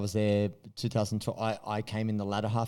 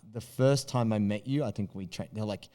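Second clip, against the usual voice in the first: ordinary talk, nothing marked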